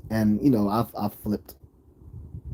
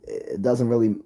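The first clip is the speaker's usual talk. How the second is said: ordinary talk, nothing marked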